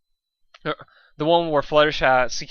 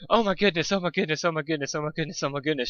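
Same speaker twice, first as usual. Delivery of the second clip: higher at first, fading away; panicked repeating; 3 semitones higher, faster than usual. A narrator's reading. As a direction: panic loop, dying down. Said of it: "she thought as she jerked away".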